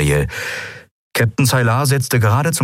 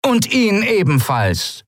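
higher, slow command; commanding order; 13 semitones higher, slower than usual. Acted. Captain ordering her first mate arrested too. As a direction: order it without looking back. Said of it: "befahl der Kapitän".

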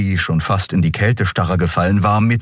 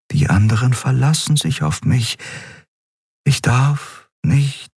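fading resolve; 7 semitones higher than usual. A narrator's reading. Last thoughts before sleep takes her.

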